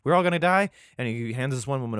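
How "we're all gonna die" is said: high, loud panicked cry; hammed-up terror; mock panic. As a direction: blurt it like everyone's doomed. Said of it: "He's like".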